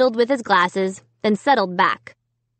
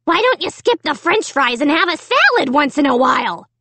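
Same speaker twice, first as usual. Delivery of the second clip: higher, rasping puppet taunt; nasty dummy; 5 semitones higher than usual. A narrator's reading. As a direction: jeer at her as a rude puppet. Dummy keeps insulting Mom.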